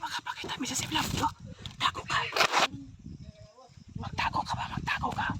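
breathy hushed urging; whispering while hiding. Acted. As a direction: whisper urgently to stay hidden. Telling friend to hide.

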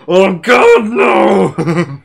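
startled yell of disgust; panicked shout. Real, not acted. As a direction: shout it in horror. Startled by dick through wall.

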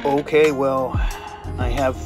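normal talking voice

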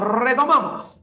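ordinary talk, nothing marked

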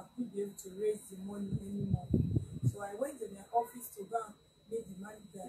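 barely audible; speaking too softly; too quiet. Real, not acted. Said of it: "Please speak louder".

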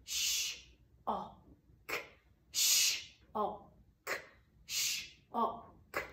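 sh and ok split apart, whispered; segmenting sounds. Shown as a demonstration. Teacher segments shock into sounds. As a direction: sound out sh, ok slowly. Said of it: "splitting it up into its sounds".

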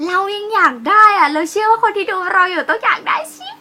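loud, high-pitched playful squeak; excited put-on high voice. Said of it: "ทำน้ำทำเสียงสูง".

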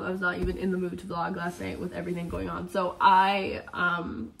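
ordinary talk, nothing marked

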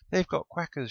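high, loud alarmed screech; panicked high-pitched outcry. A narrator's reading. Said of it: "she screeched".

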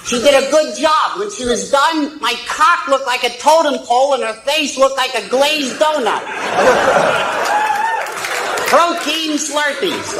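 crude puppet voice, cackling; squeaky ventriloquist dummy. Acted. Ventriloquist act, dummy speaking.

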